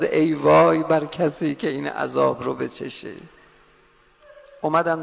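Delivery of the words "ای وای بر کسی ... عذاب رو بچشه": usual speaking voice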